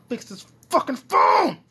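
high, loud whiny shouts; near-crying frustration; frustrated yelling. Real, not acted. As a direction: yell at the broken phone. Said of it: "listen to me complain".

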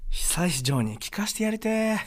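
slow, soft, drawn-out wistful delivery; acted joke line. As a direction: say it softly with mock longing. Performs listener's joke entry. (しみじみと芝居がかった口調)

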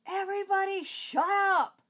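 put-on losing it, shouting at noisy kids; angry shout. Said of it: "that one day I lost it".

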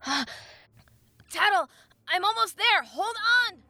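high, loud, frantic calling; urgent shouting. A narrator's reading. Link racing to his fairy, frantic shouting. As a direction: shout ahead to someone in danger.

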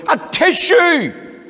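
loud high "A tissue" sneeze; an elephant sneezing hard; big sneeze. Acted. Elephant with a cold sneezes loudly.

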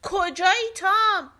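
mother calling out. A narrator's reading. Mother calling for Tom across the field, loud and slow. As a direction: call out searching for him. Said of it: "مادرش داد زد".

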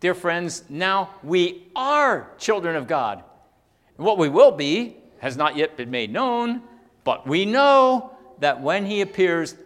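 high, loud, emphatic proclamation; preached scripture reading. Acted. Preacher reading John's letter to dear friends, proclaiming. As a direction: proclaim the verse, stretching key words. Reads scripture aloud to congregation.

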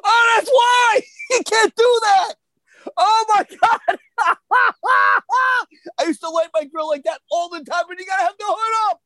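real high-pitched excited shouting; laughing disbelief. Real, not acted. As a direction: cry it out through bursts of laughter. Friend reacts in laughing disbelief.

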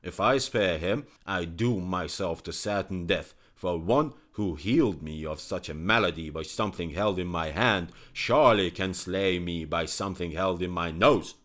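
loud, forceful royal tirade; angry king. Acted. King telling his courtiers why the physician dies, loud and angry. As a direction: insist the traitor must die. King justifies killing, shouting.

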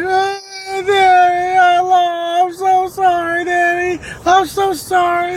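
very high mock wail; hammed-up drawn-out kid sobbing; imitated crying child. Losing boy apologizing to his daddy, imitated crying. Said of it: "He cried".

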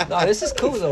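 laughing while talking. Real, not acted. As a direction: laugh through the words. Laughs at the joke.

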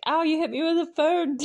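high, loud whiny complaint; mock pained yelp. Character protesting after being hit by the phone, mock pained yelp. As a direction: imitate a hurt, indignant yelp.

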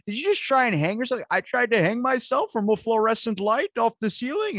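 high, loud, hammed-up film quote; acted movie dialogue. Acting out Anchorman two dialogue.

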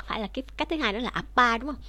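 normal talking voice

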